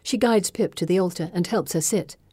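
ordinary delivery

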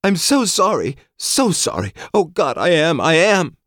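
high, frantic, near-hysterical sorry; desperate screaming apology. Acted. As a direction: scream the apology, overwhelmed with guilt.

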